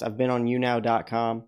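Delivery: ordinary delivery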